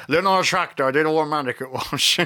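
a light laughing Bristol yokel voice; lightly put-on rural West Country twang; West Country accent. Riffing on Bristol in a light West Country accent. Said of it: "were you gonna mention Bristol".

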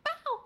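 high, held-back yelp of a bark; scaled-down, high imitation bark; demonstrated bark. Shown as a demonstration. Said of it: "I think I did that, but so loud".

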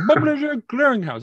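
high, loud mock Jamaican mangling of "Publisher Clearinghouse"; heavy Jamaican accent. Caller garbling the company name, heavy Jamaican accent. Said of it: "couldn't say Publisher's Clearinghouse correctly".

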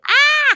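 imitating the children's high startled cry from the back seat; startled kids' shriek. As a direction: gasp out like wide-eyed scared kids. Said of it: "goggle-eyed kids like".

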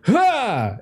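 high attack yell at normal volume; a short high "ha" on the sword thrust; lunging cry. Acted. Minuteman lunging with a sword, high attack cry.

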